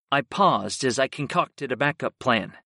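usual speaking voice

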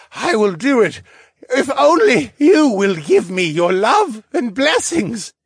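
high, loud, swooning pleading man; lovesick suitor. A narrator's reading. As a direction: plead with her, lovestruck and dramatic. Lovesick suitor's long plea.

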